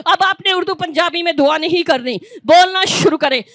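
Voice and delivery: normal talking voice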